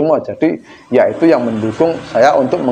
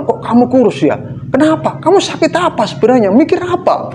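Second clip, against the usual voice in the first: sing-song fake concern, much higher; high mock-concerned busybody; 11 semitones higher than usual. Mimicking a nosy friend in a high prying voice. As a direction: pitch way up, nosy prying questions.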